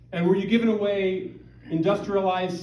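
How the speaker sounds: ordinary talk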